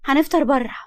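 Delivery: high, loud burst of no's; eager refusal. A narrator's reading. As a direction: protest eagerly like an excited girl. Girl eagerly refusing to eat first.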